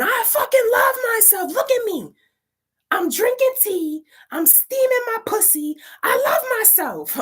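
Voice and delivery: very high, loud defiant screaming; re-enacted battle cry at the top of the range. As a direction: scream each phrase at an attacker. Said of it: "I'm screaming this".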